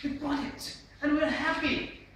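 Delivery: high, loud and spaced out; with expression. Shown as a demonstration. Trainer shows the lively way to present, emphatic and happy. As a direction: proclaim the success with feeling. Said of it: "have to have the also facial expression".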